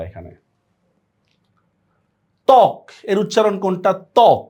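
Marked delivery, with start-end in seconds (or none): 2.5-2.8 ত্বক said "tok", cut off at the k; ত্বক as one closed syllable, "tok"; stopped final K. Shown as a demonstration. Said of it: "দেখো শেষে থেমে যাচ্ছে". 4.2-4.4 ত্বক said "tok", cut off at the k; ত্বক as one closed syllable, "tok"; stopped final K. Shown as a demonstration. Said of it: "দেখো শেষে থেমে যাচ্ছে".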